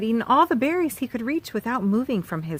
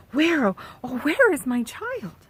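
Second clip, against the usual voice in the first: worried mother; 7 semitones higher than usual. Acted. Mother looking for child.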